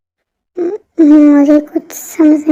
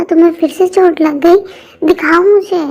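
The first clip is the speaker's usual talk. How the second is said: normal talking voice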